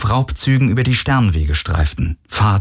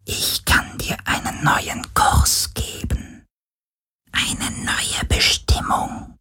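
slow, eerie whisper; ghostly, yearning, drawn-out whisper; plaintive, longing whisper; 5 dB quieter, much slower than usual. A narrator's reading. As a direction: whisper it slowly, luring the listener away. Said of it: "klagende Stimme, die ihn entsetzte".

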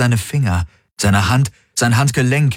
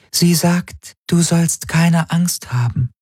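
slow, high, level alien interpreter; Helix voice; 9 semitones higher, slower than usual. A narrator's reading. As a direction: relay it slowly in a high, even tone. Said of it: "sagte die Helix". (hohe, langsame, gleichförmige Helix-Stimme)

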